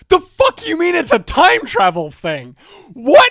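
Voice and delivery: high, loud comic outburst; acted-out indignant shock; outraged disbelief. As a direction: explode in disbelief, peaking on the last word. Retelling outrage at the reveal.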